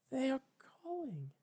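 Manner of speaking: breathless unvoiced whisper. Twilight realizes her friends call her, whispering. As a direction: barely breathe the words. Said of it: "she whispered".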